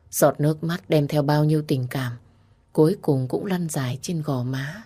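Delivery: plain reading voice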